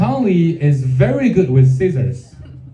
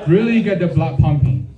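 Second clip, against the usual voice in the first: usual speaking voice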